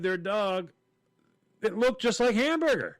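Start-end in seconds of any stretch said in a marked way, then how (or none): 1.6-3.0 high-pitched, exasperated insistence; worked-up defensive exclamation; exasperated protest. Retold or imitated. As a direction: plead your case, voice climbing. Teller protests the mix-up.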